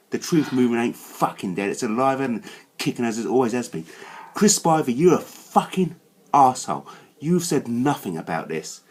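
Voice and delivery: ordinary delivery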